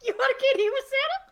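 very high, loud hype; screaming crowd-pumper; hype call. Acted. Announcer hyping again.

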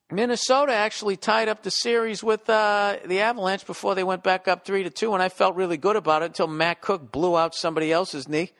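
ordinary talk, nothing marked

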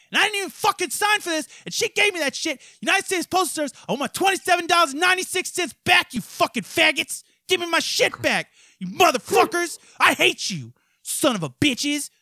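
high, loud ranting tirade; furious shout. Real, not acted. Ranting at the postal service about a lost refund, a high loud furious shout. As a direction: yell the whole grievance at them.

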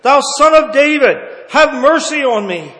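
loud, desperate plea for mercy; crying out. Acted. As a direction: call out at the top of your voice, begging. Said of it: "And he cried out".

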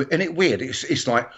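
usual speaking voice

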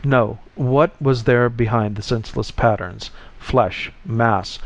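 ordinary delivery, nothing marked

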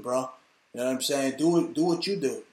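normal talking voice

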